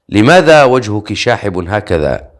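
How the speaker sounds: ordinary delivery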